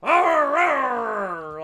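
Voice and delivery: mock grumpy old man grumble; mumbling growl. Imitating old Kent, a lightly voiced grumpy growl. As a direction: growl it like a cranky old giant. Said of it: "he would mumble".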